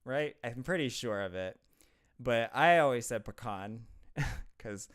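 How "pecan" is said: "pecan" as puh-KAHN; Yankee pecan. Shown as a demonstration. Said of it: "'cause I'm a Yankee".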